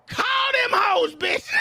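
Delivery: high, loud, slow shouted retort; answering her police threat with a yell; defiant yell. Retold or imitated. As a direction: scream it back, defiant.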